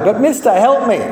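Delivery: put-on desperate driver with a Spanish accent; pleading Spanish accent. A narrator's reading. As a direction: beg for help in a Spanish accent. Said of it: "The driver had a deep Spanish accent".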